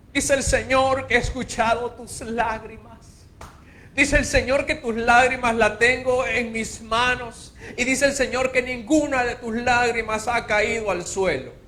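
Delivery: high, loud, hammed-up prophetic voice; mock prophet. Preacher mocking prophets who flatter weeping people, hammed-up.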